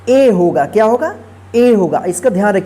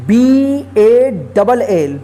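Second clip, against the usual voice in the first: letters spelled out slowly one by one; slow spelling; much slower than usual. Shown as a demonstration. Teacher spells word letter by letter.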